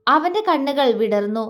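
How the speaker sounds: plain reading voice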